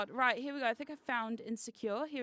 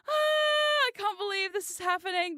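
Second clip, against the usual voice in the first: high, loud burst of excitement; excited; 8 semitones higher, 5 dB louder than usual. Real, not acted. Streamer about to watch the video with viewers, excited. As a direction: open on a long high cry, then gush. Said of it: "I'm just excited".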